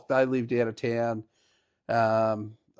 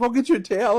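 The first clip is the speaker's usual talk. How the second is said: higher-pitched, flustered imitation; mock-panicked, raised pitch; panicking girl; 9 semitones higher, faster than usual. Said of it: "panicking, the poor girl".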